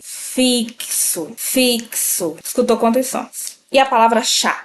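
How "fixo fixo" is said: Fixo said as fik-so, each sound distinct; careful pronunciation. Shown as a demonstration. Teacher repeating Fixo so students count its sounds. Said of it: "Quantos sons você consegue ouvir?".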